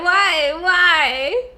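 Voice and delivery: loud pleading whys; anguished why. Real, not acted. Streamer grieving the game's ending, loud pleading whys. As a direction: cry out why at the screen. Emotional reaction to game ending.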